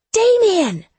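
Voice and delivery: very high, loud scream. A narrator's reading. Lex bangs on Damien's door, screaming. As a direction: scream the name while pounding. Screams again while banging.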